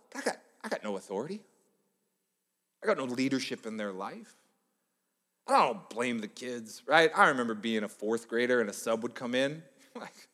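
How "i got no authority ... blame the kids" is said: ordinary delivery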